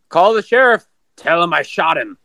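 neighbor yelling. Retold or imitated. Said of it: "He goes".

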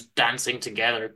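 usual speaking voice

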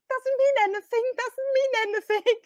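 high mock-innocent reassurance; evasive brush-off. Relatives brushing off awkward question.

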